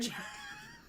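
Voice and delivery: helpless high-pitched laughter; high squealing laugh. Real, not acted. Gamer cracking up at own play, squealing laugh.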